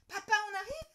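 put-on high, loud impatient child; child crying out impatiently. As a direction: call out eagerly to papa. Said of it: "crie Lola impatiente".

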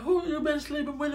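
high-pitched mock mama voice; mother confronting daughter. Mama demanding to know the real father, indignant.

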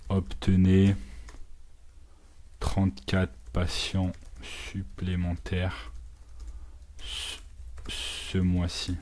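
spelling out the subject line slowly as he types; each word dragged out while typing; slow dictation. Shown as a demonstration. Typing an email subject line, dictating it slowly to himself. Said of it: "je vais mettre".

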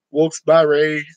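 Wilkes-Barre with Barre as "bar-ray"; alternative pronunciation. Shown as a demonstration. Listing local names for viewers, Wilkes-Barre said as "Wilkes-bar-ray". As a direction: say Wilkes-Barre with an open "ah", ending in "ay". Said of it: "They call it Wilkes-Barre".

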